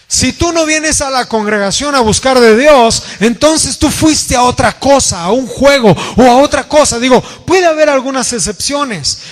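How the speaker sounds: high, insistent preacher's delivery; full-force preaching. Real, not acted. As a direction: preach it urgently, pitched well above talk. (predicación enérgica, con voz alta y aguda)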